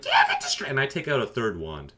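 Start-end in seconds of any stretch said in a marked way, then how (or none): 0.0-0.7 squeaky, very high taunt; gloating kid. Retold or imitated. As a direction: crow over the win like a little kid. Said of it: "he goes".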